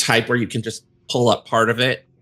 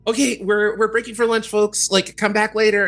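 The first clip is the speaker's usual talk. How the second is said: Cal's voice, higher; 11 semitones higher, faster than usual. Acted. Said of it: "like, just".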